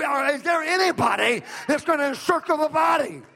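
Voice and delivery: loud, high, driving preacher's cry; full-force preaching. Acted. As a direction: preach it to the rafters, building the room up.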